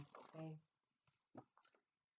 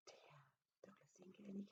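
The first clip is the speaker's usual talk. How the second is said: soft, quick sad whisper; whispering the sad news to children; 2 semitones higher, 17 dB quieter, faster than usual. A narrator's reading. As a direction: breathe it out, sorry for him. Teller whispers sad aside.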